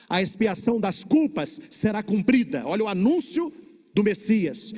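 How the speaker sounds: ordinary talk, nothing marked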